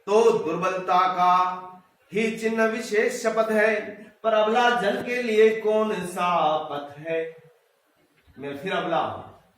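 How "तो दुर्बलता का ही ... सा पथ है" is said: slow, drawn-out verse reading; poem recitation. Acted. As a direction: recite verse with long, measured syllables. (धीमा, लयबद्ध कविता-पाठ)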